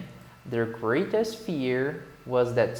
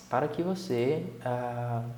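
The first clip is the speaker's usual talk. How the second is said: ordinary delivery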